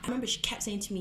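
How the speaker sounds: ordinary delivery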